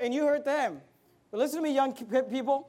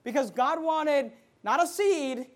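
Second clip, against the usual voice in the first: usual speaking voice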